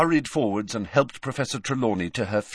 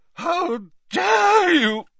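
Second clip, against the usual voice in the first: drawn-out, high-pitched shriek of outrage; wailing, outraged screech; scream; 8 dB louder, much slower than usual. A narrator's reading. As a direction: scream it indignantly, stretching each word. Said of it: "Harry heard a scream".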